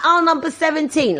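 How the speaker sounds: high-pitched call across a supermarket; mock holler, not full volume; imitated calling back. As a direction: sing out the aisle number. Calling aisle number to mum.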